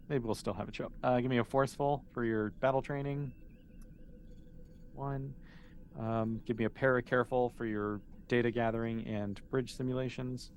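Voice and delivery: normal talking voice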